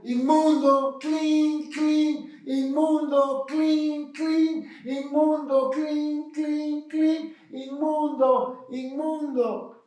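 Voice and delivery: high, sustained chant with imitated bell clangs; leproso gritando. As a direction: cry out like a leper ringing his bell. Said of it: "con una campana gritando". (pregón agudo y sostenido de leproso con campana)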